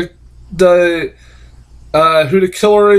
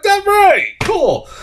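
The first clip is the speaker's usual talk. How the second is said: much higher, squeaky cheer; mock excited; much slower than usual. Reviewer gloating that he guessed the killer, in a high mock cheer. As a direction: shoot up high for a silly victory cry. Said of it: "And I was like".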